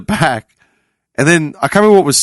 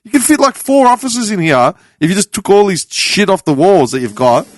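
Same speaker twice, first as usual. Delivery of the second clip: higher-pitched mock exasperation; incredulous rant; 6 semitones higher than usual. Storyteller mocking an office full of paper folders, incredulous rant. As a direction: pitch it up, ranting in disbelief.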